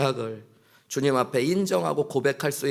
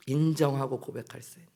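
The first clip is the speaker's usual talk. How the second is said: ordinary delivery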